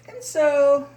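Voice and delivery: loud, high, drawn-out launch; dramatic transition. Acted. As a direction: project it grandly to open a new chapter. Monologue resumes, big transition.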